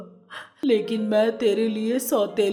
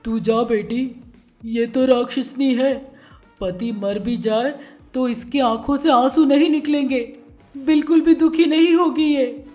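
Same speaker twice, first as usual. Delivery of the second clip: bitter father; 7 dB louder than usual. A narrator's reading. Father denounces wife.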